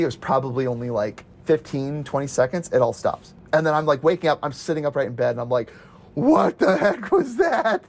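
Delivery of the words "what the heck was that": high, slow, stunned question through a laugh; dragged-out startled what-the-heck; startled disbelief. Retold or imitated. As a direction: stretch it out, half laughing in shock. Woken by earthquake, retold.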